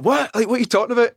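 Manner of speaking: retold panic, pitch jumping high; high-pitched, freaked-out disbelief; freaking out. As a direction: ask it in a rising, high fluster. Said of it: "I started freaking out".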